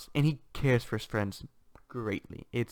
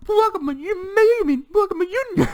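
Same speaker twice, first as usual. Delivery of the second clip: hammed-up grand entrance; 7 dB louder than usual. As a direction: burst in with a mock-heroic squeal. Characters burst through the door.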